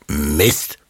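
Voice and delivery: the beaten villain mutters; wolf cursing. Acted. Foiled wolf curses to himself, mild grumble.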